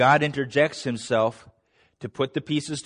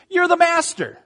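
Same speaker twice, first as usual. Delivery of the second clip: much higher, mock-reverent master; higher, teasing you're the master; mock flattery; 13 semitones higher, 3 dB louder than usual. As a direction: ham up Peter's flattery. Mock Peter flattering Jesus.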